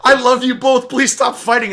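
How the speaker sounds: high, loud mock plea; pleading chat viewers. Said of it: "people in the chat were like".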